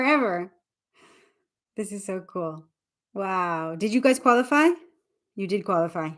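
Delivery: normal talking voice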